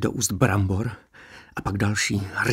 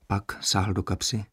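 usual speaking voice

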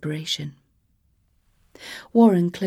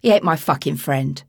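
Cockney growl; 3 semitones lower, 4 dB louder than usual. Acted. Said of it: "growled in a Cockney accent".

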